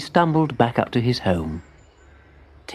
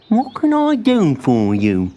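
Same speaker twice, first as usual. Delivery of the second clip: put-on slow, kindly old voice; bumbling mole; 6 semitones higher, 3 dB louder, slower than usual. A narrator's reading. Muddle greets Timbertwig, slow and kindly. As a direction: offer help slowly.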